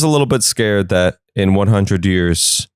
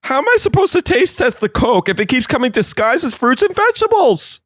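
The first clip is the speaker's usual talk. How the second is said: very high squeaky complaint rising higher at the end; a shrieking cop wailing about fruit disguises; whiny officer voice; 14 semitones higher than usual. Acted. Officer whining about disguised drugs.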